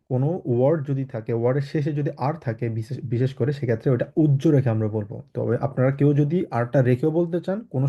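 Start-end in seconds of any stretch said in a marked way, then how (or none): none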